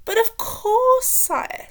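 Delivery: high, loud, slow unctuous courtier; Spittleworth voice. A narrator's reading. Adviser assuring the king, high, loud and slow. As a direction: draw it out with oily flattery. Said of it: "said Spittleworth".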